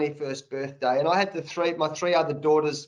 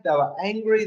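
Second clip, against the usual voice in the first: ordinary delivery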